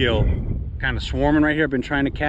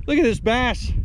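excited; 8 semitones higher than usual. Real, not acted. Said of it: "My voice, my voice getting a little high".

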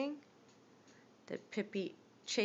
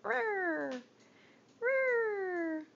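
mock roar; 10 semitones higher, 4 dB louder than usual.

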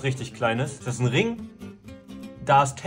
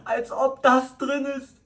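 high, drawn-out astonishment; can't believe the find, voice way up; amazed disbelief; 9 semitones higher, slower than usual. Real, not acted. Vlogger finds prize in the bag, amazed disbelief.

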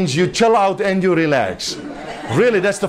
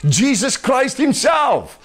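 raised and slowed, shouting the answer; preaching shout; 6 semitones higher, slower than usual. Real, not acted. Preacher calling out the answer to the congregation, slow high shout. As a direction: proclaim it high, drawn out, to the whole church.

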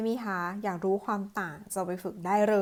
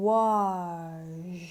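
slow sh; 4 semitones lower than usual. Shown as a demonstration. Teacher slowly models wash, tongue staying still. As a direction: stretch wash and let the sh air run. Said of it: "ปลายลิ้นไม่ขยับ".

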